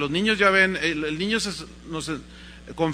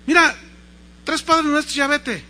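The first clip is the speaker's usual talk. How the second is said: high, loud dismissal; acted impatient confessor; brusque priest; 9 semitones higher, 7 dB louder than usual. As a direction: hand out penance and send the child off. Priest dismissing child with penance.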